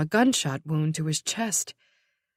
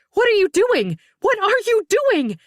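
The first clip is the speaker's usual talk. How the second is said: high panicked shriek; 13 semitones higher, 7 dB louder than usual. Acted. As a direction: scream it in shock.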